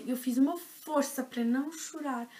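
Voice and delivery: ordinary delivery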